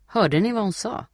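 rally the others with a taunt; taunting disbelief. A narrator's reading. Boy to his friends about Steffi, taunting.

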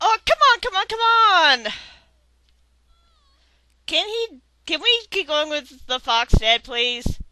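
loud high desperate begging; frustrated pleading. Real, not acted. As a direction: plead with the game, exasperated. Begs the game loudly.

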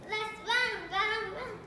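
excited pumpkin cry. Acted. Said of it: "poem pumpkin flees".

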